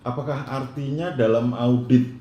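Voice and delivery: ordinary talk, nothing marked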